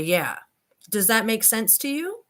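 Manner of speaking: normal talking voice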